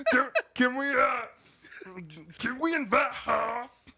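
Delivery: high, loud, halting hillbilly. Acted. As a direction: ask it in a slow high put-on voice. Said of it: "be like to be a redneck homosexual".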